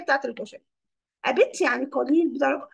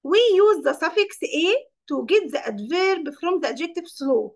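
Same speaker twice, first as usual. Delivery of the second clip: slow dictation; much slower than usual. Shown as a demonstration. Teacher reading a grammar rule to the class, very slow. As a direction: read the rule out slowly.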